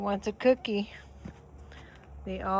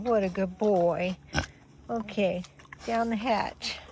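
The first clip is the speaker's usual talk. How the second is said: ordinary talk, nothing marked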